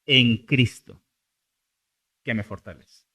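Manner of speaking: "en Cristo" set apart with a long pause after; stress on EN CRISTO. Shown as a demonstration. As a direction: land firmly on "en Cristo", pause, drop away. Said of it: "lo importante es lo segundo".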